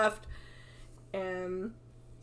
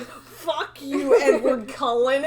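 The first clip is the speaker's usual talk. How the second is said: laughing mock insult; 6 semitones higher, 10 dB louder than usual.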